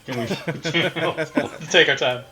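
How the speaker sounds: normal talking voice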